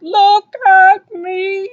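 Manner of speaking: high, long-drawn loud holler; yelling out. Acted. Reader showing Mister Magee's speech bubble, drawn-out yell. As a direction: stretch each word into a far-carrying call. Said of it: "he's yelling out".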